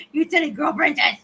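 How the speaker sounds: said through a laugh; amused, laughing words. Real, not acted. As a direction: chuckle at the silly cat. Laughing at the cat.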